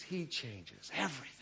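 ordinary talk, nothing marked

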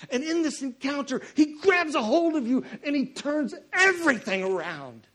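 loud, high-pitched urgent exhortation; full-force preaching. Real, not acted. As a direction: press the message home to the congregation.

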